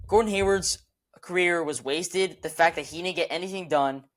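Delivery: usual speaking voice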